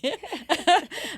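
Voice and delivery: high, loud burst of laughter. Real, not acted. Ri do que Deus respondeu. (gargalhada aguda)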